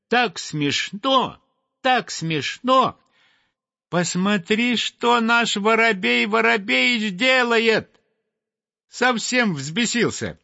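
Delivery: giggly titmouse squeaking; thin little voice. A narrator's reading. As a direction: titmouse telling the sweep about the sparrow, high and thin. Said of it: "засмеялась тоненьким-тоненьким голоском".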